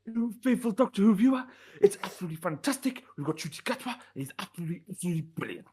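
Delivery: put-on Welsh accent, starting high and quick; Russell T Davies. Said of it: "Russell's here".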